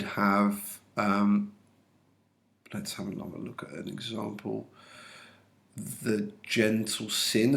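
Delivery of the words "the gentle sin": slow, measured "The gentle sin", word by word; syllable demonstration. Shown as a demonstration. Teacher showing an example of syllable counts, slow separated "The gentle sin". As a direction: set out "The", "gentle", "sin" slowly as separate units. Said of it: "a one, a two, and a one".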